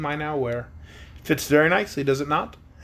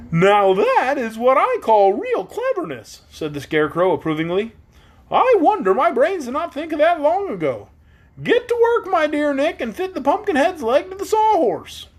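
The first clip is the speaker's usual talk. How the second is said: very high, louder delighted straw man; Scarecrow voice; 13 semitones higher, 4 dB louder than usual. A narrator's reading. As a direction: squeak with excitement.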